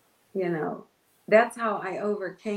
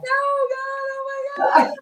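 high, loud mock wail; imitated sobbing; 7 dB louder than usual. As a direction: act out a hammed-up sobbing plea to God. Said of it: "I'm sobbing, crying".

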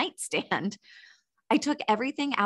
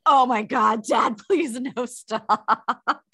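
louder, drawn-out mortified begging through laughter; retold embarrassed wail; mock horrified plea; 3 semitones higher, 5 dB louder, much slower than usual. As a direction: beg Dad to stop, hammed-up and laughing. Retelling her horrified plea to dad.

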